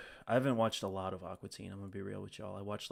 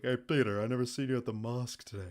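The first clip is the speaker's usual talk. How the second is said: louder, quick Joe voice; Joe impression; 3 semitones higher, 4 dB louder than usual. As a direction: toss off the Joe line fast. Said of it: "He was like".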